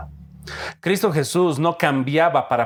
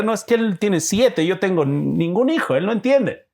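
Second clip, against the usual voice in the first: lightly mocked complaint, pitched higher; complaining childless couple; 6 semitones higher than usual. Childless couple judges the father of seven, mock complaining voice. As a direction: imitate a couple objecting to their pastor. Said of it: "la pareja soltera le va a mirar".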